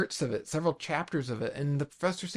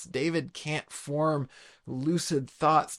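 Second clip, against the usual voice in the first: each word laid down slowly, evenly; preacher explaining the Psalm to his congregation, slowly and deliberately; slower than usual. Shown as a demonstration. Slow measured delivery.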